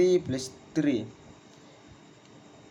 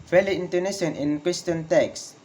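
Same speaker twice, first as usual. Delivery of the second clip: normal talking voice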